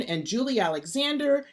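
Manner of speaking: usual speaking voice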